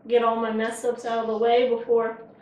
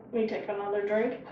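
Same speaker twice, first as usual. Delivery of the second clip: usual speaking voice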